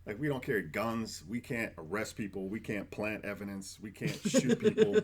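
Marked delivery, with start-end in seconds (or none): 4.3-5.0 mock regret. Real, not acted. Joking about firefighters' limits.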